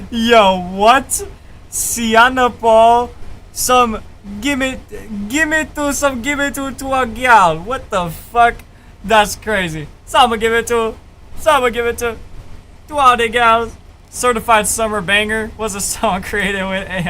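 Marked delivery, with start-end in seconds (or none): 0.1-7.6 high Jamaican dancehall chant. Retold or imitated. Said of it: "It's a [name] impression". 10.1-13.8 high Jamaican dancehall chant. Retold or imitated. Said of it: "It's a [name] impression".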